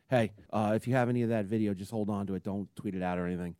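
low, soft secretive phone voice; a hint of a worried boss on the phone; hushed serious warning. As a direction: murmur it like a nervous boss. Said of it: "Mike Babchek calls me".

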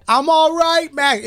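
high, echoing voice from inside a tube; through a tube. Retold or imitated. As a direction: call out from inside a water slide. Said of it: "my tube voice".

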